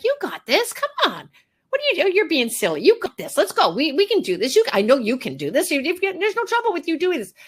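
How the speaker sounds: high, bright pep-talk cheer; encouraging a shy child. Acted. Groomer cheering on a nervous dog like a shy child, high and eager. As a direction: pump up a timid kid with rising, eager encouragement. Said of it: "bolster a really shy child".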